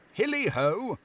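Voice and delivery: jolly master calling out with glee; jovial cry. Acted. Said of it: "cried old Fezziwig".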